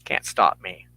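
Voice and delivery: normal talking voice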